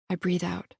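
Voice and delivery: ordinary delivery, nothing marked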